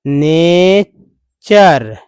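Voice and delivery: नेचर drawn out slowly, syllable by syllable; slowly sounded out. Shown as a demonstration. Teacher slowly spelling out a word for students. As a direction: stretch नेचर out for the class. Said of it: "तो इस तरह से हो गया".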